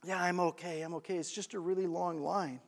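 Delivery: low, soft, weary reassurance; worn-out lady. Retold or imitated. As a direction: play a tired woman brushing off concern. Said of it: "the lady in the chair says".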